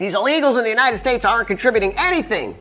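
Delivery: high-pitched mock grievance; mock complaining. Arguer griping about immigrants, mock complaint. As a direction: imitate an indignant complainer, pitched well up. Mimics one side of the argument.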